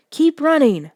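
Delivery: high, loud shout; shouted while fleeing. A narrator's reading. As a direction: urge her on as you run. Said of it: "I shouted".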